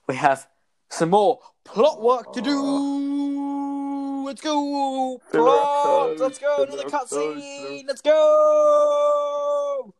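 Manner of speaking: very high, slow, squealing excitement; squealing hype. Pretend. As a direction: squeal it out like a hyped fan.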